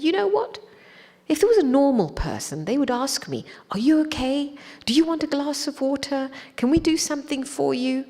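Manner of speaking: high-pitched, worked-up protest; indignant child. Retold or imitated. Young disciple protesting to the teacher in the car, indignant. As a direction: retell it as an affronted child's complaint. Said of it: "indignant child".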